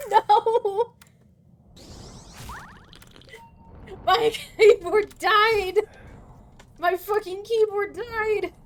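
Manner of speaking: high loud laughing wail; distressed wail. Real, not acted. Keyboard died, laughing despair.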